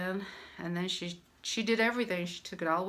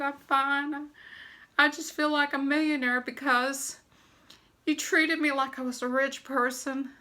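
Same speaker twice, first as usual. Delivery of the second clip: higher and louder, choked up with tears; tearful thanks; 6 semitones higher, 5 dB louder than usual. Retold or imitated. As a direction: thank her through tears. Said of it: "I started crying".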